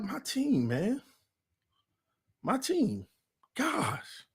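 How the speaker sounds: soft, a touch choked; emotional sigh. Retold or imitated. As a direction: quietly lament the team. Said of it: "I got emotional".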